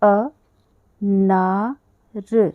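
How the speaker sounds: अ ना र split into slow separate syllables; spelled out for children. Shown as a demonstration. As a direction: break अनार into slow syllables. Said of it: "उच्चारण क्या कर रही हूँ".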